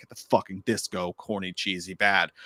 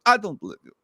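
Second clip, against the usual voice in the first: higher mock Italian accent; Russell Crowe; 6 semitones higher, 3 dB louder, faster than usual. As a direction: imitate an Italian exorcist. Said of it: "And Russell Crowe's like".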